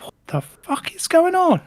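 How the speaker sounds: high, slow, stunned whisper-like gasp of confusion; bewildered disbelief. Retold or imitated. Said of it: "and I was like".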